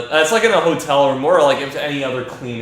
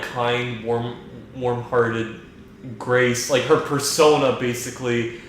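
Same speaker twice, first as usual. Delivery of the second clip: ordinary talk, nothing marked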